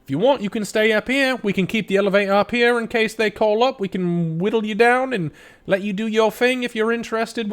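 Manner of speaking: high, blunt foreman woman explaining; Blue Nori voice. Acted. Blue Nori keeps talking.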